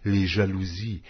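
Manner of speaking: usual speaking voice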